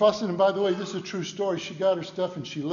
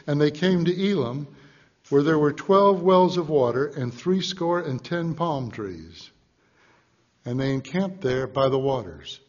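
louder, slowed Bible reading; scripture reading; 3 semitones lower, 4 dB louder than usual. Acted. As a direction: read the verse out to the church. Preacher reads scripture aloud.

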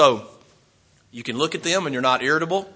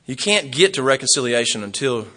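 ordinary talk, nothing marked

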